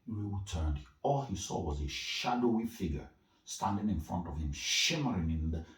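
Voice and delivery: ordinary delivery, nothing marked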